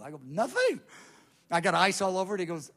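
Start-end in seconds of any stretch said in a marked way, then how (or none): 0.2-0.8 high acted denial; defensive reply. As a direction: pitch jumps up in mock innocence. Acted answer to friend's question.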